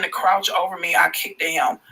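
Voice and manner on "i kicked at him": ordinary delivery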